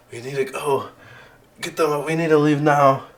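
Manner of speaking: insistent, alarmed command; urgent. Acted. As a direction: push them out with rising force. GM voices Winter urging escape.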